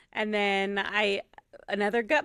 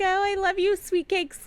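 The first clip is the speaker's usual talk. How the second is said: put-on high, louder cooing, as if to a child; babying sweet talk; 11 semitones higher, 5 dB louder than usual. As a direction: imitate someone doting on a big child.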